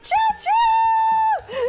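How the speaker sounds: high, loud choo-choo squeal; train whistle imitation. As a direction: toot loudly like a train.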